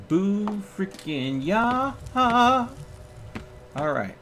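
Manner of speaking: high, slow, drawn-out victory whoop; triumphant cheer. Real, not acted. Streamer cheering at the points, high and drawn out. Earning three skill points.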